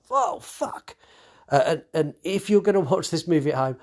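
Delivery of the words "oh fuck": much higher startled yelp; a high startled cry; startled shriek. Retold or imitated. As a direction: squeal like you just jumped out of your seat. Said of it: "it's like".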